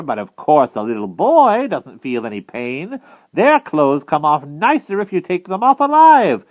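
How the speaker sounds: loud, high, swooping witch; Madam Mim. A narrator's reading. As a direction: explain the cruelty with wicked relish.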